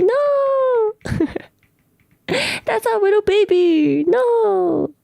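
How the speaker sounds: put-on high-pitched babying lament; cutesy, very high mock grief; mock wailing. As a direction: wail over him like a lost puppy. Mock mourning a beloved character.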